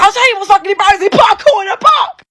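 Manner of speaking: fast, high angry shout; shouting him down at the station. Retold or imitated. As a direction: yell it as the final blow. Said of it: "I was like".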